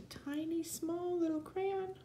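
squeaky little voice for a tiny crayon. Acted. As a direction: make yourself sound tiny. Voicing tiny crayon size.

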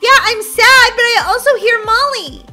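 high, loud, exasperated insistence; insistent protest. Acted. Brookie tells the teacher she hears Molly, insistent protest. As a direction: push back to be believed.

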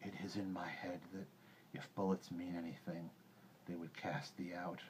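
soft, hushed wolf voice; quiet, husky counsel from a wolf; composed old wolf. A narrator's reading. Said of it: "sitting down composedly".

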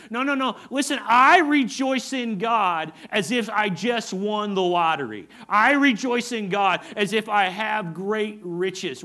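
loud, slow, jubilant declaration; joyful shout of rejoicing; excited David. Acted. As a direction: proclaim it like you just won the lottery. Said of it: "David's saying it like this".

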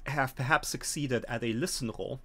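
ordinary talk, nothing marked

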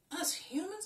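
ordinary delivery

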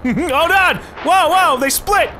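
high panicked shouts; startled yelling. Real, not acted. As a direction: yelp in sudden alarm. Surprised by splitting missiles.

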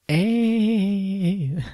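high, airy ghostly "Hey". Retold or imitated. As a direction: call out like a playful ghost. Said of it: "in the background going".

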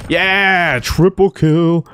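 high, drawn-out kill celebration; triumphant cheer. Real, not acted. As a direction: stretch it out in high-pitched triumph.

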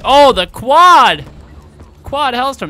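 loud, high, drawn-out cry; excited shout. Real, not acted. Gamer lands a quad kill, excited shout. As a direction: shout it out, stretching the words.

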